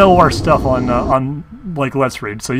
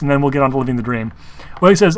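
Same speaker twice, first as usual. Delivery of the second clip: ordinary delivery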